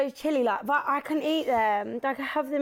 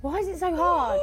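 ordinary delivery

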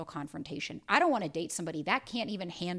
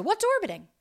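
puzzled question; 12 semitones higher, 7 dB louder than usual. Retold or imitated. Voicing a follower's question.

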